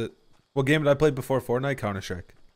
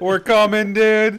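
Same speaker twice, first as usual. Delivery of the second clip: loud; 8 semitones higher, 9 dB louder, slower than usual. Real, not acted. Rushing under fire.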